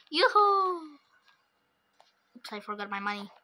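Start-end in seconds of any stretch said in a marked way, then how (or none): 0.1-1.0 high, loud sing-song yoo-hoo; gleeful call. Pretend. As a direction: call out like a delighted child. Gleeful call after handing money.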